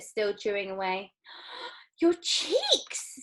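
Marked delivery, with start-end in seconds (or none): 2.0-3.1 very high shriek; scream. A narrator's reading. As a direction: scream at the sight. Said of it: "screamed Missus Beauregard".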